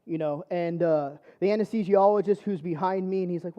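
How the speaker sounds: ordinary talk, nothing marked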